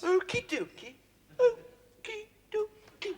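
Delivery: high, drawn-out playful okie dokie; sing-song okie dokie. Acted. Listener sings it back high to tease the narrator. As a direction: toss it off high and bouncy. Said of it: "rolls off the tongue".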